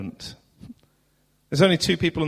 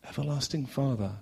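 soft reverent repeat; 8 dB quieter than usual. Shown as a demonstration.

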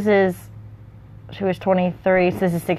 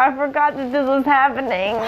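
higher, plaintive realisation; dismayed wail; 6 semitones higher than usual. Real, not acted. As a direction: wail it as the sad memory hits. Reader dismayed by sad plot turn.

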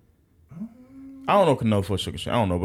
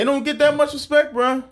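high-pitched tease. Real, not acted. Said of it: "high teasing".

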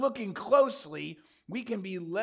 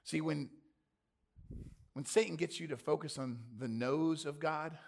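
normal talking voice

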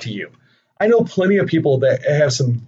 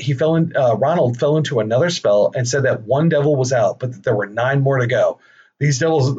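normal talking voice

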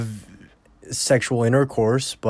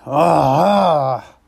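louder high groan; pained groan; 4 semitones higher, 5 dB louder than usual. Real, not acted. Embarrassed by his own joke, louder groan. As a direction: let out an anguished ah.

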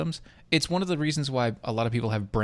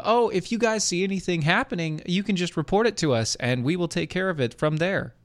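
higher-pitched mock reassurance; mock police spokesperson; 6 semitones higher than usual. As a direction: quote the police sarcastically, pitched up. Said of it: "in Portland said".

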